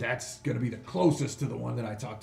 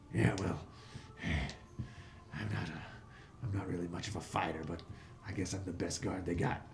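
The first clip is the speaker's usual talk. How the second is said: low, soft, lightly voiced gnoll; a touch of tired, unenthusiastic guard; world-weary gnoll guard; 4 semitones lower, 5 dB quieter than usual. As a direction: mumble it like a resigned old watchman.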